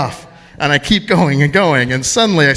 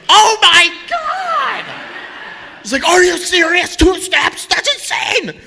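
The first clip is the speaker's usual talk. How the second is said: imitating a nurse's much higher, shrieking disbelief; shocked nurse; slower than usual. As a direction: squeal it like a stunned nurse. Nurse shocked by two steps.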